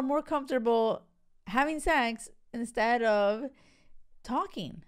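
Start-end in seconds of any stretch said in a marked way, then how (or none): none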